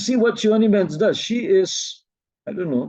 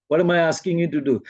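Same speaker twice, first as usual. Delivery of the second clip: ordinary talk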